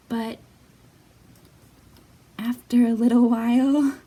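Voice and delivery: put-on lively read-aloud for small children; storybook reading. A narrator's reading. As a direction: read it like storytime, bright and expressive. Reading picture book to children.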